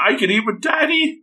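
put-on high, excited little-kid voice; playful high-pitched glee; thrilled child, imitated. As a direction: act an overjoyed child, pitch way up. Acted child's joy at eating together.

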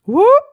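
sudden high, loud whoop. Pretend. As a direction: cheer it out with a big whoop. Cheering after quoted roar.

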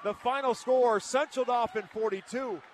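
loud, excited game-end call; play-by-play call. Acted. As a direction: shout it like the final buzzer.

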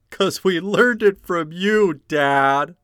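loud, high accusing outburst; hammed-up accusation. Kid blaming Dad for learning it, hammed-up loud accusation. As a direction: throw it loudly at Dad. Acted line in the podcast intro.

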